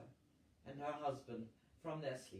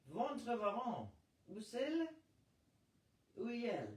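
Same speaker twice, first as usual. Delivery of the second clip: raised, demanding American French; insistent French; 9 semitones higher, 3 dB louder than usual. A narrator's reading. A woman presses the captain in French. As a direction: press the question in clumsy French. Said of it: "Hugo's vocabulary failed her".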